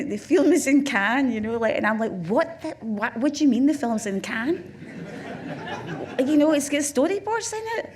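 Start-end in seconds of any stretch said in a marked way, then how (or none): 0.1-1.3 high, loud retold proclamation; imitating a triumphant declaration; mock announcement. Acts financier announcing the news. 2.3-7.9 high, loud incredulous protest; comic, laughing incredulity; retold disbelief. Acts own disbelief at the news.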